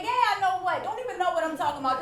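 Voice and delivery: hammed-up husband playing along; teasing husband. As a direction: tease her back quickly. Husband voiced teasing her.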